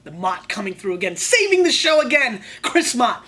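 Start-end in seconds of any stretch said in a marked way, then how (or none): 1.1-3.2 loud, high praise; excited shout. Real, not acted. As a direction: cheer him on loudly.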